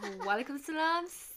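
high, loud, exaggerated sweetness; overly formal greeting. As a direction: greet her with hammed-up formality. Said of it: "why are we being cringe?".

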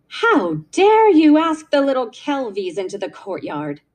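indignant scolding, swooping up on the reproach; cold fury. A narrator's reading. Aunt scolding her niece for inviting the Kelvies, coldly furious. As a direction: scold with icy outrage. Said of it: "said her cold, furious voice".

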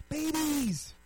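high, loud comic yell; mock outraged shout. Comic host answering whom to ban, mock outraged shout. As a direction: shout it out as the big punchline.

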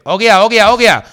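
loud, high call of Ogia; nutter calling out. Retold or imitated. Mimicking a nutter at the bar, loud high Ogia calls. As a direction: call Ogia over a crowded bar like a madman. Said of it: "un zumbao que dice".

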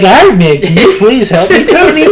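put-on high, loud needy kid; hammed-up plea climbing to a squeak; mock begging. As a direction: beg your hero, half laughing. Mocking Peter begging Tony for help.

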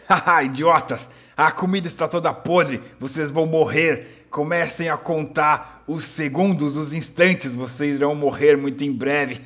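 loud gloating villain, tongue tied by beard; sneering panto villain. Acted. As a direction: jeer at them, mouth half blocked. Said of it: "com uma certa dificuldade, já que ele fala amarrado pela própria barba".

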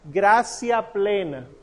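GRACIA PLENA, slow, with GRA-sia; S pronunciation. Shown as a demonstration. Teacher modelling the Ave María line, slow s pronunciation. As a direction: draw out gracia plena with an s sound. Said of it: "Como si fuera S".

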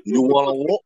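lightly put-on Cuban tough-guy voice, building on the second war; a swaggering wrestler's taunt, only lightly voiced; Razor Ramon impression. Shown as a demonstration. For the host who asked, a mild Razor Ramon voice. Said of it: "What's your best Razor Ramon impression".

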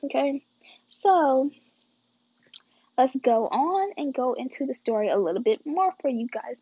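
ordinary delivery

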